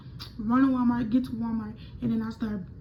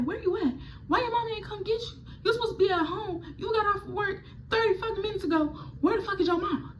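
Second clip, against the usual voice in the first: much higher, fast scolding rant; a hint of an irate father; lightly voiced angry dad; 10 semitones higher than usual. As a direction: daughter imitating her cursing dad.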